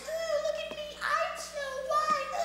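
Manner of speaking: high mocking princess voice; mock her in a whiny high voice. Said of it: "she's got that whiny voice".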